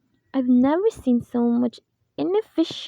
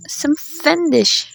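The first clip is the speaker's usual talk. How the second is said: panicked drawl; 3 semitones higher, 6 dB louder, much slower than usual. Acted. Panic builds.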